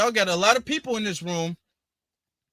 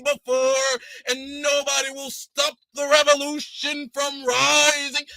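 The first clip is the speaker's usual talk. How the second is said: hammed-up rally speech, slow and higher-pitched; mock oratory, swelling on drawn-out words; civil rights activist; 5 semitones higher than usual. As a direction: declaim like a fake revolutionary at a podium. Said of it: "Nobody talks like this".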